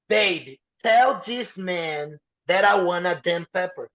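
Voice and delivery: slow, drawn-out mock demand; exasperated demand. Diner tells wife to deal with waiter, slowly exasperated. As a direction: spell it out impatiently, word by word.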